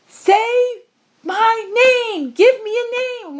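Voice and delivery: put-on spoiled child-king yelling to be named; childlike emperor screaming. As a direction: shriek like a petulant boy emperor demanding a name. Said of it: "the childlike emperor screaming out".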